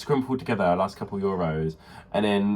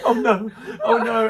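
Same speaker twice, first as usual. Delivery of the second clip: high, loud mock-panicked wail, laughing; mock dismay; 12 semitones higher, 6 dB louder, slower than usual. Teller acts the girl finding only snags, high mock dismay. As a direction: play her dismay up for laughs.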